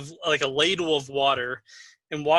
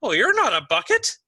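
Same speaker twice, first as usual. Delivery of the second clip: put-on higher-pitched old man voice; gristly old man; 8 semitones higher, 4 dB louder than usual. Old man spots someone in a jar, surprised high voice. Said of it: "gristly eyebrowed old man".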